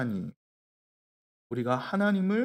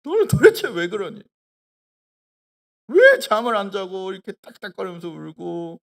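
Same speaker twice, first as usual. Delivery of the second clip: put-on scolding child; 3 semitones higher, 5 dB louder than usual. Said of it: "너무 화딱지가 나서 제가 뭐라 그랬어요".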